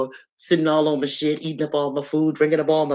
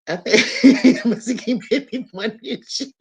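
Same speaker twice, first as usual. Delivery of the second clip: real giggles all through the line, higher pitch; laughing while talking; 5 semitones higher, 3 dB louder than usual. Real, not acted. Streamer remembering what was funny, talking through laughter. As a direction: squeeze the words out through laughter. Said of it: "It do be funny".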